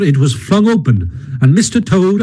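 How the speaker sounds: ordinary delivery, nothing marked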